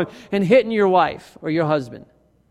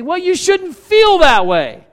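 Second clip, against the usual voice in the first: higher, louder, slow brush-off; dismissive husband; 9 semitones higher, 6 dB louder, slower than usual. Retold or imitated. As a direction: brush off the feeling like a fixer. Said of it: "we say".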